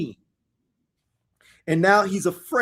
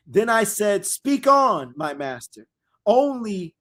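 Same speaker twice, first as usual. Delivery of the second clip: dramatic scripture reading; 4 semitones higher, 3 dB louder than usual. Acted. Preacher reading prophecy aloud to his listeners, solemn and loud.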